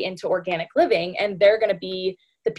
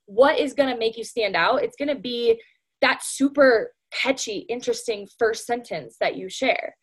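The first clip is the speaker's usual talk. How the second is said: ordinary talk, nothing marked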